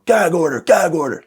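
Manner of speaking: high mock dad yell. Dad's courtroom term called out, mock yell. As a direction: call it out high, like dad's scream. Mimicking dad's scream once more.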